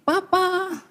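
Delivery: soft, drawn-out wifely call; submissive wife. Acted. Wife greets husband home from work, soft put-on voice. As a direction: softly coo it like a devoted wife. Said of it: "istrimu sudah begini".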